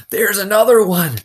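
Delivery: high, loud frightened gasp; gasped alarm. Acted. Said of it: "gasped Mister Pendanski".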